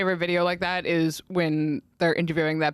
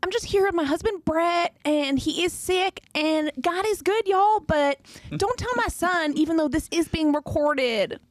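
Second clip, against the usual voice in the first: much higher, bright y'all twang; Southern reality-star voice; 10 semitones higher than usual. Retold or imitated. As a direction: gush to the camera like a reality mom. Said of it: "She's like".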